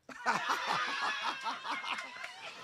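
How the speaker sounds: unvoiced high laugh; a burst of airy laughter; high breathless laugh. Real, not acted. Game leader laughing at the players' mistakes, breathless high laugh.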